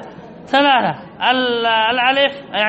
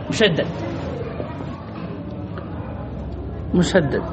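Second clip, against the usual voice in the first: soft drawn-out term; 5 semitones lower, 9 dB quieter than usual. Shown as a demonstration. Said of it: "يسمى في علم القراءات".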